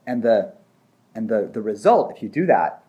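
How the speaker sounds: ordinary delivery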